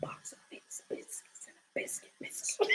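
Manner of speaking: soft whispered tongue twister; whispering. Shown as a demonstration. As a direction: whisper each word. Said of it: "your expressions while whispering".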